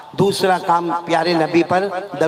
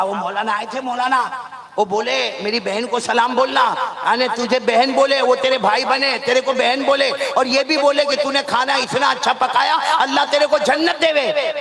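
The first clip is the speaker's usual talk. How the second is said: delighted husband; 6 semitones higher than usual. Acted. Husband brings his wife the maulana's greeting, delighted. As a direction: pitch climbing as he passes on the blessing. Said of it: "وہ شوہر اتنا خوش ہو گیا".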